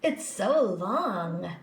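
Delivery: put-on slow, drawn-out teasing boy voice. A narrator's reading. Schoolboy Joe mocking her long name, slow and teasing. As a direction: stretch it out to mock her.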